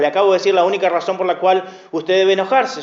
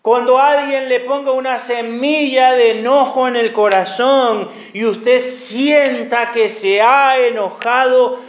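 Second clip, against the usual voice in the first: ordinary delivery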